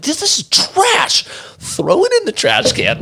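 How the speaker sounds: mock contempt, "trash" stretched high; mock scornful. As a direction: drag out "trash" in high, exaggerated disgust. He slams the shoe samples.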